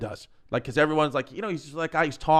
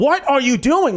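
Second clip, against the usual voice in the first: higher-pitched mock scolding; retold exasperation; 9 semitones higher, 4 dB louder than usual. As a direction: replay telling dad off, pitched up. Son retells scolding his father.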